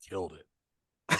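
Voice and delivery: low, slow, satisfied mutter to himself; smug self-praise. Retold or imitated. Leaving Whitney's house, gloating alone in the car, low and slow. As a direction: savor the win under your breath.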